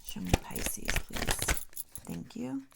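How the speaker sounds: breathy whispered plea. Real, not acted. Reader whispers a plea to the tarot deck. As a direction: murmur it to the cards.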